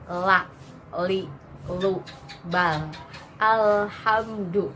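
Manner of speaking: la li lu bal alhamdu with the tongue pushed out; exaggerated wrong al in alhamdu, slowly. Shown as a demonstration. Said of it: "tidak tepat".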